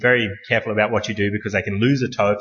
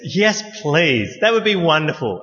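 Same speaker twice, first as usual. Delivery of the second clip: relieved thanks; 3 semitones higher than usual. Retold or imitated. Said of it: "I said".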